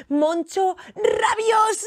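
building to a drawn-out roar; slow, loud and rising, growling out the name; raging announcement. A narrator's reading. Storyteller revealing Moncho's angry side, roared.

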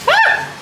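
short, high, loud squeal; sudden high cry of delight; excited squeal. Real, not acted. Baker sees the cookies out of the oven, excited squeal.

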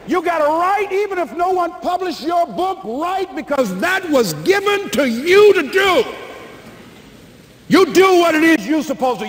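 rally the crowd to chase their calling; a motivator's rising sermon; fiery preaching. Acted. Preaching at full force.

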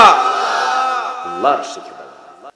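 slow, loud proclamation; drawn-out proclamation. Real, not acted. Preacher proclaims God's teaching to the crowd. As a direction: stretch out the answer. Preacher answers his own question.

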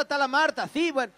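ordinary talk, nothing marked

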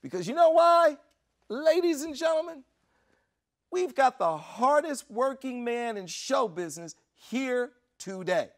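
high, loud emcee introduction; showman's announcement, ringing out; shout for the whole room. Retold or imitated. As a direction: introduce the star to the whole crowd. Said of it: "wants the whole party to hear this".

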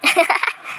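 short breathy chuckle; brief relieved laugh. Acted. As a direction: let out a quick laugh. Friend reassured, laughs.

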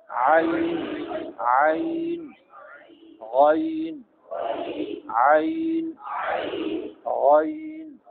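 आइन and गैन drawn out, from the throat; Arabic pronunciation. Shown as a demonstration. Teacher demonstrating Arabic ain and ghain. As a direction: sing out आइन and गैन slowly, Arabic style. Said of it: "मगर अरबी में इनका तलफ़ुज़ क्या है".

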